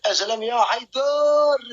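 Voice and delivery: high, stretched cheer to a supporter; drawn-out call. Real, not acted. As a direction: call out high, holding the name. Calls out to battle supporter. (نداء عالٍ ممدود)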